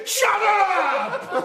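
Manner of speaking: high, loud shout, half laughing. Retold or imitated. KGB man at Anant Ashanti, laughing mock shout. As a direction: bark it at him while laughing. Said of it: "then he said".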